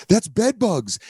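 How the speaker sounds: hammed-up alarm, voice way up; freaked out. Coworker warning Julia about bites, high panic. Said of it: "this other girl fucking freaked out".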